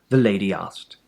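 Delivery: ordinary delivery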